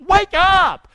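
high, loud shout; shouted call. Real, not acted. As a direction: rouse the room with a shout. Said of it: "Say that with me".

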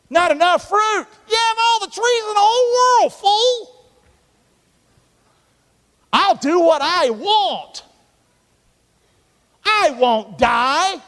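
high, loud petulant grumbling voice, slowing at the end; voicing Adam and Eve. Preacher voicing Adam and Eve to the congregation, high and petulant. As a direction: whine like a spoiled child who wants the fruit. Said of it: "Adam and Eve. Look at it".

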